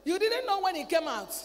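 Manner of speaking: ordinary talk, nothing marked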